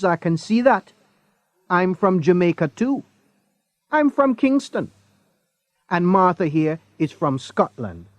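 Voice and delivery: a hint of a motherly Jamaican woman; friendly Jamaican woman. A narrator's reading. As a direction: Elsa welcoming Craig as a fellow Jamaican. Jamaican woman voiced in story.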